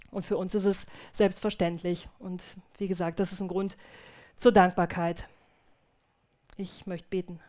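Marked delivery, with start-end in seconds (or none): none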